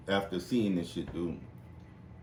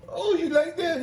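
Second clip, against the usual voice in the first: high teasing; 6 dB louder than usual. Retold or imitated. Teasing old man in game.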